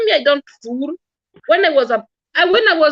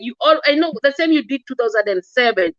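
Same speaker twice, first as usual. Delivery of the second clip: usual speaking voice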